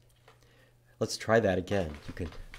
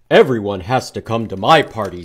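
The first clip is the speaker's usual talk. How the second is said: much louder, bossy moose; loud insistent moose host; 11 dB louder than usual. Acted. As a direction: boom it out like a big moose. Moose voiced in picture book.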